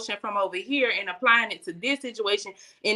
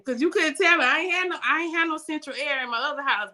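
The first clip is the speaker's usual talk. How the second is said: normal talking voice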